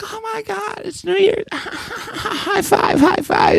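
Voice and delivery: mock fangirl squeal. Mocking staged crowd members acting thrilled on camera, high fake squeal. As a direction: ham up a fake thrilled fan giggling for the camera.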